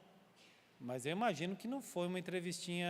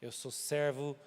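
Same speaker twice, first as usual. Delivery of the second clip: slow, grave admission; solemn confession; 3 semitones lower, much slower than usual. Retold or imitated. Jonah confessing to the sailors who he serves, slow and grave. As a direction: let each word weigh.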